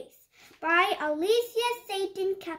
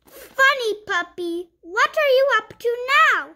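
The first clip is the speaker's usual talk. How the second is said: lively picture-book read-aloud; reading to children; 5 dB louder than usual. Acted. Reading a puppy picture book to young viewers, bright storytime voice. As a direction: read it like storytime for little kids.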